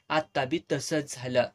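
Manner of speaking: ordinary delivery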